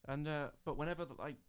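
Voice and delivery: normal talking voice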